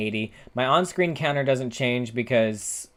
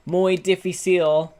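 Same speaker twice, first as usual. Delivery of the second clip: slow, high mock Spanish phrase; drawn-out playful Spanish accent; 8 semitones higher, 6 dB louder, slower than usual. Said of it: "as they say in the Spanish".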